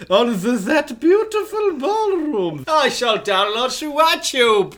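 high, giggling through the words; laughing. Real, not acted. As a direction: laugh while repeating it.

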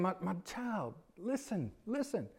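the father softly pleading with his angry son; tender plea. Retold or imitated. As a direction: coax a hurt child gently. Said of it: "like he's saying".

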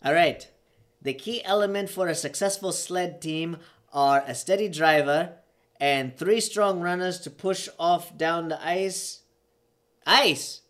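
high, loud Jamaican character voice; Jamaican accent. Acted. Podcaster acts a sled team scene, heightened Jamaican accent. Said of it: "I gotta do an accent and a character".

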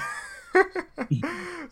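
real high-pitched burst of laughter. Real, not acted. Laughing at the name mix-up.

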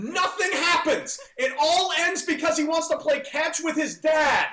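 high, loud shout breaking into laughter; hammed-up laughing rant. To podcast hosts mocking the ending, a laughing shouted rant. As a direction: rant on while cracking yourself up.

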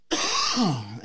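harsh real coughing burst; coughing fit. Real, not acted. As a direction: cough hard, mid-sentence. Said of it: "I'm sorry I'm coughing so much".

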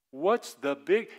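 ordinary delivery

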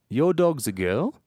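put-on statement swung up high into a question on "girl"; upwards inflection. Shown as a demonstration. Giving an example of Australian speech, rising question tune on "girl". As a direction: lift "girl" sharply, as if asking. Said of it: "asked as a question because of the inflection".

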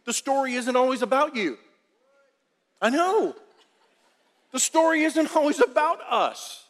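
rising emphatic preacher stress; emphatic point. Shown as a demonstration. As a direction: drive home the story isn't always about us. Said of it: "repeated key line".

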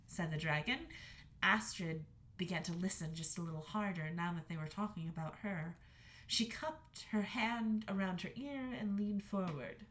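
ordinary delivery

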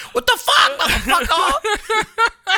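very high, loud imitated outburst; mock furious shout. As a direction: shriek it as a hammed-up rage. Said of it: "when she gets mad, she's like".

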